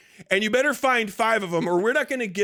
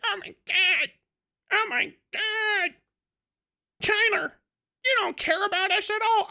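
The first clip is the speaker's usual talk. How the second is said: mock whining; 10 semitones higher than usual. Ranter mimicking foreigners upset at being ignored, mock whining. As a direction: put on a high complaining foreigner.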